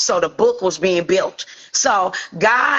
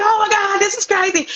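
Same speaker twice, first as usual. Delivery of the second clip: very high-pitched mock freak-out; screaming excitement; 12 semitones higher than usual. Mother hears daughter's Prince news, retold screaming excitement. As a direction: imitate a thrilled squeal without full volume. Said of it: "We screaming".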